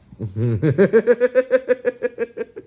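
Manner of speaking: high burst of laughter. Real, not acted. Owner laughing at the grumpy cat.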